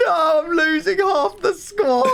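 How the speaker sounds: high, crying shout of defeat; despairing wail. Real, not acted. Gamer losing half his squad, wailing and shouting. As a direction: wail like it's all lost.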